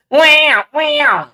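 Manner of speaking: high, loud mock baby cries wham wham; imitated baby wail. Teasing viewers with the baby's crying, loud mock wail. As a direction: belt it out like a screaming infant, playful. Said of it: "Y'all know how them baby crying".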